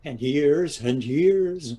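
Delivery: slow, drawn-out "And years and years"; husband drags the jab out at his wife; slow tease. Acted. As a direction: stretch it out to rub it in. Husband teasing wife's age.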